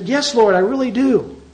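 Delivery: reply to the Lord like a keen volunteer; eager reply. Retold or imitated. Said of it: "And I said".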